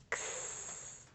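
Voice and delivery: "X" as a voiceless ks hiss; letter sound. Shown as a demonstration. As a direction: breathe out the ks sound. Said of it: "Give me the sounds".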